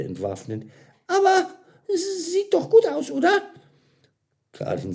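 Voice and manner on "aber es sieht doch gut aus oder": high, loud disarming plea; hopeful server. A narrator's reading. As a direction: coax them with a high, eager voice. Mira smiles disarmingly, pleading approval.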